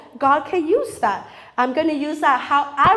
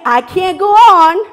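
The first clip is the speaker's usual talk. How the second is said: despairing cry; 5 semitones higher, 8 dB louder than usual. Pretend. Voicing a despairing thought.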